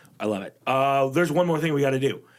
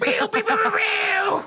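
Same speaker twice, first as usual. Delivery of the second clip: laser zap noises, high and loud; Foley time-travel effect, zapping high; high pew pew zaps; 4 dB louder, slower than usual. Pretend. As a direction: fire off cartoon laser sounds. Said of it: "sound effect for time travel".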